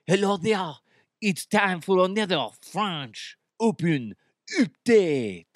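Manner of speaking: slow, hammed-up French accent; Frenchie DeHuff. Shown as a demonstration. Radio host opening a tennis update, comic French accent. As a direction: announce it in a cartoon French accent. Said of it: "you know, Frenchie DeHuff".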